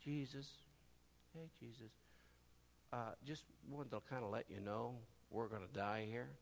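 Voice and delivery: soft, mumbled offhand understatement; underplay it like nothing's wrong; mock casual. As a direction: disciples mildly mentioning their doom. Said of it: "do you think they said".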